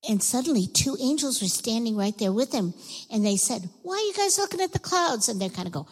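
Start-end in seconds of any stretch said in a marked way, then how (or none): 3.8-5.3 high-pitched acted question; incredulous angel's question; puzzled questioning. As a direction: ask it high, as if baffled. Acting angels questioning the disciples.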